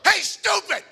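high, loud insult shouted across the yard; angry yell. Retold or imitated. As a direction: bark it at the man with the rifle. Palaga yells at the shooter.

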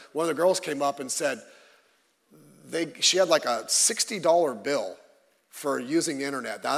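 usual speaking voice

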